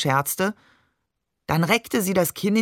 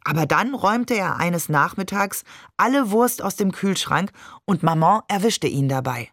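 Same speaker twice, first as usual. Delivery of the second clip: ordinary delivery, nothing marked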